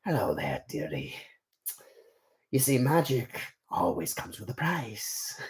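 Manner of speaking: imitation of a sly dealmaker; Mister Gold. Shown as a demonstration. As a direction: greet softly, then draw out the warning. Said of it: "He kinda sounds like this".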